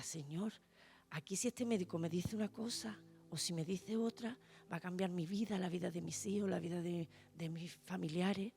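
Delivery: mucho más suave, casi para sí; oración íntima. Retold or imitated. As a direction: reza bajito, con temor. Recuerda su oración angustiada. (recordando su oración a solas, en voz muy baja)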